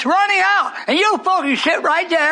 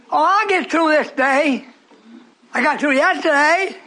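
normal talking voice

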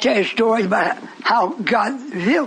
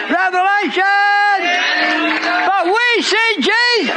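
preaching at full force; 10 semitones higher, slower than usual. Real, not acted. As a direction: cry it out to the congregation. Preaching climax shouting.

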